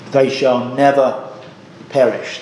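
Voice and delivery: slow, firm stress on "never" in "They shall never perish"; emphatic quote. Shown as a demonstration. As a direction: press each word of "They shall never perish" slowly. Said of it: "The words are very emphatic".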